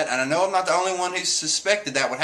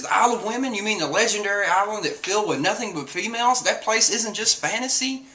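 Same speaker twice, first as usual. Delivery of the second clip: mock disbelief; 7 semitones higher than usual. Voicing Usopp's disbelieving question.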